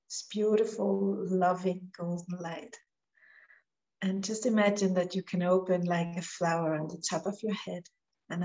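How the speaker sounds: soft meditation voice with long pauses; guided meditation. Real, not acted. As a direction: gently guide them into relaxing, letting phrases fall away quietly.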